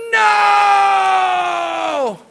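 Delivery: high, loud, long drawn-out cry; devastated howl of loss; anguished shout. Retold or imitated. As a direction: wail it as your truck lies wrecked. Fell to his knees, he went.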